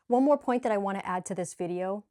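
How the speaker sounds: normal talking voice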